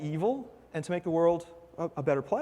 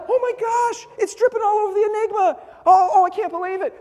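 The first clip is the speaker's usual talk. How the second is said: retold panic, much higher in pitch; freaked out; 13 semitones higher, 5 dB louder than usual. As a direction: squeal it like you're freaking out. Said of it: "I honestly freaked out".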